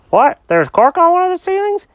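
high, eager cat character; excited cat voice. Acted. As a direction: bounce it out like an excited kitty. Jenkins voice.

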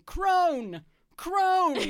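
loud, high-pitched comic bellow; mock yelling. Knight riding through the forest, yelling for the crone. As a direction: holler it out like a movie knight. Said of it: "rides through the forest yelling".

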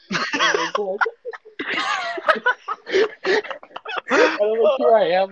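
high, loud laughing through the words. Real, not acted. Telling friends about crying on the bus, laughing. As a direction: laugh it out, voice climbing.

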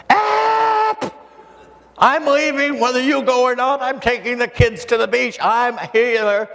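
put-on mother telling Dad she's taking the kids; mother's shrill demand. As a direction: play the mother laying down the law. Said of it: "and a screamer".

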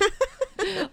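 a high laughing burst. Real, not acted. Laughing at the sassy catchphrase. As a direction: laugh out loud at the joke.

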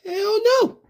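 ordinary talk, nothing marked

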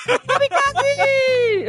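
high, slow stretched-out battle cry; drawn-out kamikaze yell. Retold or imitated. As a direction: yell it while leaping. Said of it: "ele gritou o quê?".

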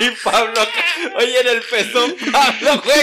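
loud laughing teasing. Real, not acted. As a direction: cry out the name through laughter. Laughing hard at the story.

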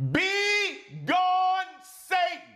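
very high, drawn-out banishing yell; high-pitched, angry preacher's rebuke; yelled command. Shown as a demonstration. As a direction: yell the devil away. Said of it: "need to yell that right now".

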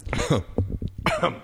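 sudden coughing fit; coughing after swallowing the wrong way; choking on a drink. Real, not acted. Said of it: "Choking on that drink?".

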